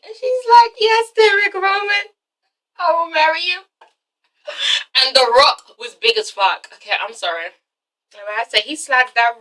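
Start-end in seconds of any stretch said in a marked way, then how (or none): 0.8-3.6 acted overcome, drawing out the words; tearful acceptance. Girlfriend accepting Derek's proposal, tearful high singsong.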